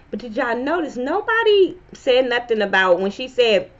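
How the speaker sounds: ordinary talk, nothing marked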